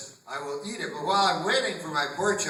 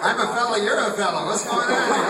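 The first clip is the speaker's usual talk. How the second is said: mock-indignant diner, higher and louder; baffled protest; 7 semitones higher, 6 dB louder than usual. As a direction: protest in playful alarm at the waiter. Diner baffled by seductive waiter.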